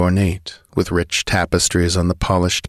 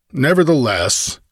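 higher, mildly impatient retort; 7 semitones higher than usual. A narrator's reading. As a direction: cut in with a touch of impatience. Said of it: "answered the outlaw impatiently".

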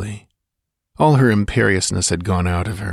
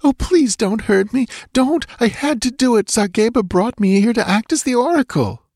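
high-pitched, terrified begging; hysterical pleading. A narrator's reading. Captive dancing girl begging Conan not to hurt her, hysterical. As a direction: plead for mercy, near hysteria. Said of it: "her sobs quivered with a note of hysteria".

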